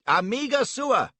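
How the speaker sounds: high, loud call across the water; loud, high friendly shout; cried greeting. Acted. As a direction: hail the boatman from a distance. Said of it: "cries he".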